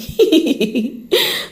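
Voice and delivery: high tittering giggle. Real, not acted. Podcaster signing off to listeners, giggle.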